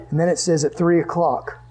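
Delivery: normal talking voice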